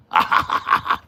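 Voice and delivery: loud, staccato forced cackle; mocking laugh. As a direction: laugh in the chatter's face. Mocking the chatter's attempt.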